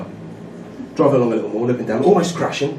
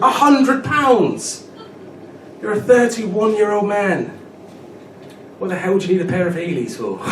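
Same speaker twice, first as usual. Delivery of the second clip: put-on incredulous girlfriend; 6 semitones higher than usual. Girlfriend mocking his shoes.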